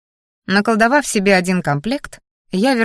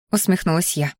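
ordinary delivery, nothing marked

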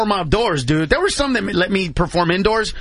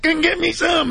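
higher, sing-song hillbilly taunt; 10 semitones higher than usual. Retold or imitated. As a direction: egg him on like a goofy country boy. Redneck kid quoted.

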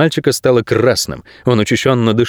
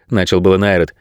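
usual speaking voice

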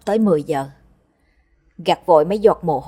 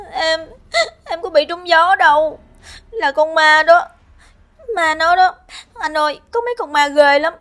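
much higher, loud tearful wail; frightened sobbing; 11 semitones higher, 4 dB louder than usual. Acted. Kim crying to brother Hoàng about the ghosts, sobbing. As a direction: cry out to your brother in fear.

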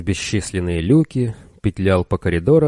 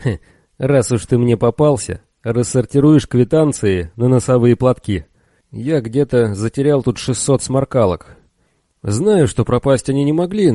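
gloating; 5 semitones higher, 4 dB louder than usual. Acted. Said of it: "злорадно хихикнул".

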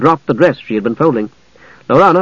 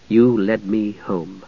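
even slower, softer remembered words; fading echo; 4 semitones lower, 6 dB quieter, much slower than usual. A narrator's reading. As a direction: let the echo die away. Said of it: "the words echoed".

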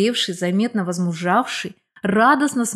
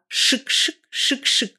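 whispery imitation of riffling banknotes; imitated swishing; 5 semitones higher, slower than usual. Woman mimicking her friend's deft counting, imitated swishing. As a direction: swish it out like notes being flicked.

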